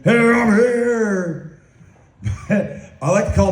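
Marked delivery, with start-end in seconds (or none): 0.0-1.6 high, slow booming mock entrance; Kool-Aid man. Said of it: "Kool-Aid man".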